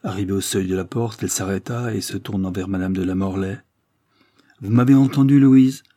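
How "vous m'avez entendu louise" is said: nettement plus fort et plus aigu; impose-toi d'une voix forte; ordre sévère. Acted. Madame de Sipierre ordonne sévèrement. (injonction forte et sévère)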